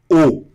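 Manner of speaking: "O" as a closed o, loud; closed O sound. Shown as a demonstration. Teacher repeats the A plus U sound, "O" as closed o. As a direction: punch out "O" as one closed vowel. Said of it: "é um O fechado mesmo".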